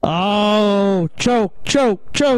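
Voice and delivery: mock taunt. Mocking the failed accent challenge.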